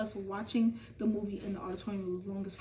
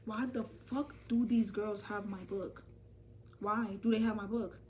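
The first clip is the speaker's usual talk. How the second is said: ordinary talk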